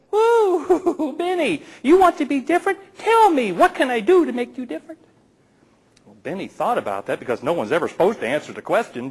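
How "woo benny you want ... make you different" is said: high, laughing fairy godmother voice; a giddy, much higher fairy with a laugh; fairy frog mother. Acted. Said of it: "and she said".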